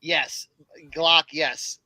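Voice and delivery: normal talking voice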